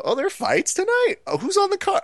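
very high, curious newcomer voice; clueless newcomers. Retold or imitated. Bar-goers trickling in before the fights, high and clueless. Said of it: "people who slowly trickle in and go".

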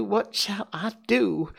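slow, high despairing repeat; tearful wail. Acted. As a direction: drag it out in despair. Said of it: "burst out crying".

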